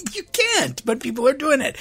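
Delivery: very high, squeaky incredulous protest; incredulous laughing protest. Real, not acted. Priest protesting that people are doing it, amused incredulity. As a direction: pitch it way up, exasperated and amused.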